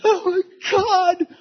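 high, loud terrified cry of a woman waking. Retold or imitated. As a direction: cry out in terror as if someone is in the room. Wife's night terror, acted.

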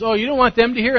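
a touch of mock surprise. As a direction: tease their request in a high voice. Commander mocking the request.

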